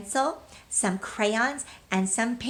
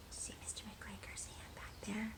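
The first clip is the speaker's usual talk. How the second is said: whisper; 20 dB quieter than usual. Acted. Suspense whisper to children.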